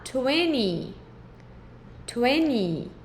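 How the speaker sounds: twenty said "tweni", T dropped after the N; twenty without the T. Shown as a demonstration. Teacher modelling twenty for Brazilian students, T dropped. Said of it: "vai retirar o som do T".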